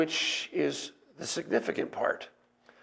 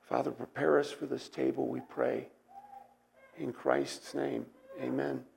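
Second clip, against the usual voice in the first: hoarse, fading voice; losing voice, nearly a whisper; raspy whisper; 3 semitones lower, 10 dB quieter than usual. Real, not acted. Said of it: "I'm losing my voice rapidly here".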